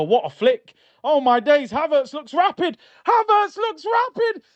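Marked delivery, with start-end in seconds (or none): 3.1-4.4 much higher, screaming the name in disbelief; real goal-celebration shriek; excited scream. Real, not acted. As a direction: shriek it as the ball goes in. Repeat screamed as Havertz scores.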